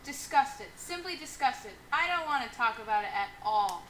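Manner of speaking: disgusted. Acted. Prim wife.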